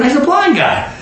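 ordinary talk

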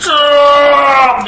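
long, high humbled-dog noise; imitated dog groan. Imitates the humbled dog's reaction.

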